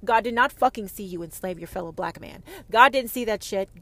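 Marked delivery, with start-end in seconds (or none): 2.7-3.8 louder declaration. Real, not acted. Ranting at the audience about God, louder. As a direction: punch out God, louder and firm. Repeated line, now louder.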